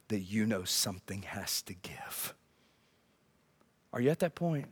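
low, near-whispered appeal; hushed question. Real, not acted. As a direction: ask it softly, straight to the heart. Preacher's intimate question to congregation.

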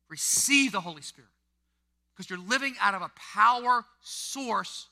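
loud, high, driving sermon delivery; full-force preaching. Acted. Pastor preaching hard to the congregation about Peter, loud and high. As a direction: preach it hard to the whole room.

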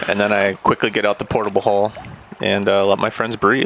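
normal talking voice

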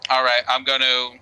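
usual speaking voice

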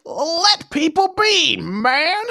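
put-on high, slow grizzled old miner; old prospector. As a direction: drag it out like a crusty gold digger. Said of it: "but you're an old prospector".

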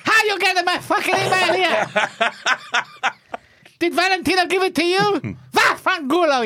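put-on high, suspicious Italian accent; mock-indignant Italian-accented English; Giacomo Agostini. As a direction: demand it like a comic Italian. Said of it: "you need to translate this one".

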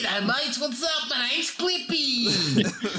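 hammed-up Australian accent, pitched high, slow and laughing; Clippy the paper clip as a jokey Aussie, hammed-up Australian accent; bad Australian Clippy. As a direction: overdo a cheesy Australian twang. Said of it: "I want bad Australian Clippy".

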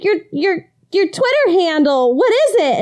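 high, insistent question; high pitched. Retold or imitated. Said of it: "I'm like".

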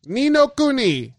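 hammed-up Japanese accent on "Ni no Kuni", rising high. Shown as a demonstration. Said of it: "I had to put an accent on it".

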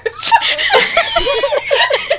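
loud, high burst of laughter; shrieking laugh. Real, not acted.

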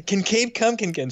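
put-on high, eager kids pleading; lightly voiced children begging; little boys begging. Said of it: "they're asking".

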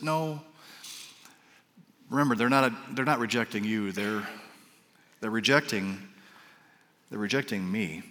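soft, gentle voice of God; tender. Retold or imitated. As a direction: reassure him softly, like a shepherd. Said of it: "very tenderly".

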